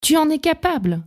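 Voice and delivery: acted high-pitched encouragement; encouraging partner. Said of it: "de ton conjoint qui te dit".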